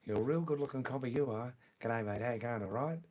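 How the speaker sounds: fast, broad Australian patter; Australian accent. Shown as a demonstration. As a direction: run the words together in Australian. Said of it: "in Australian accent also".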